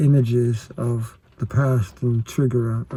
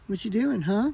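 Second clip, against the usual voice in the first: much higher sing-song coo to a pet; 10 semitones higher than usual. Real, not acted. Talking to the pet fox.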